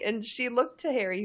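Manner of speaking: normal talking voice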